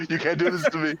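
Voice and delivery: P=A; mock outrage. Mock outrage over tea in pool.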